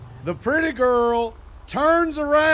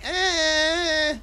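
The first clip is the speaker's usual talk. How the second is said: unimpressed grunt; 7 semitones higher than usual. Retold or imitated. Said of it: "And I was like".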